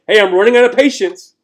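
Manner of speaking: high, very loud parental warning; fed-up parent. Acted. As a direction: call it out like a parent at the limit. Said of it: "parents will say".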